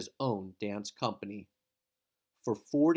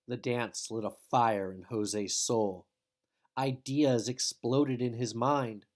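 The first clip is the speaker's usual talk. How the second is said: ordinary delivery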